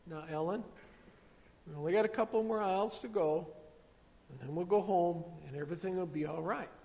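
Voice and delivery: soft, soothing mother; very gentle voice. Retold or imitated. Mom in the cookie aisle keeping herself calm, very gentle. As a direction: speak softly, as if calming someone fraying. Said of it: "in a very gentle voice".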